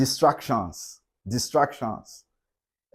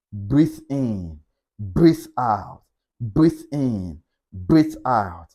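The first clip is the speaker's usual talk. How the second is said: calm breathing guide; 4 semitones lower, much slower than usual. Shown as a demonstration. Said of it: "Stay calm".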